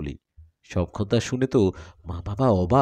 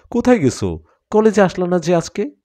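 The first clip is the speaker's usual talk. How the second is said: put-on girlfriend's voice; 8 semitones higher, 5 dB louder than usual. A narrator's reading. Girlfriend voiced, asks eagerly.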